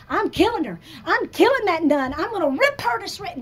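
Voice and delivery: high and loud, furious ranting beast; mock inner rage. Her inner beast raging.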